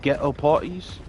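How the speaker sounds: imitating a hard British accent, rising ends. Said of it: "the hard British accent".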